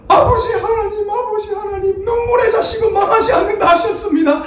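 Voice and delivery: mother crying out a tearful prayer for her son; mother's tearful wailing prayer. Retold or imitated. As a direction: wail it like a weeping mother at dawn prayer. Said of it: "기도하면서 하시는 말씀이 뭔지".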